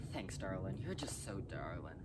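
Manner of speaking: breathy creepy whisper. Acted. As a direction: lean in close and whisper it creepily. Creepy aside to listener.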